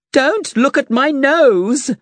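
high, slow, drawn-out shout; a knight crying out in embarrassment; hammed-up shout. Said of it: "shouted the knight".

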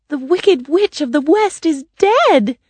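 put-on high girlish proclamation, punched at the end; jubilant cry. A narrator's reading. Dorothy announcing the witch's death, crying out high. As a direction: call out the great news. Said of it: "Dorothy cried".

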